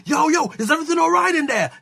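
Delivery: high, fast, urgent check-in; mock concerned calling. Production team outside the steam room, urgently calling in. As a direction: call through a door in a hurry.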